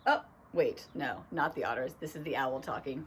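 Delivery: ordinary delivery, nothing marked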